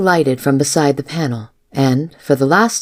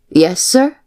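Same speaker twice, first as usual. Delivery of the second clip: higher-pitched dutiful servant's reply; 4 semitones higher than usual. A narrator's reading. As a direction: answer obediently with a questioning lift. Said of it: "She answered dutifully".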